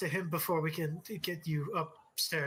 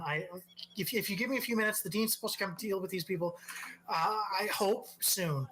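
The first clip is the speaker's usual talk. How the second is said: flustered receptionist; 3 semitones higher, faster than usual. Acted. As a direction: apologize in a frantic rush. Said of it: "and the receptionist".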